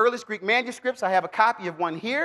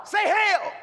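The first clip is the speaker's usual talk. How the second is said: high call to the congregation; raised, rallying voice; 10 semitones higher than usual. Real, not acted. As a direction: lead the crowd in a shouted response. Leading congregation in a shouted response.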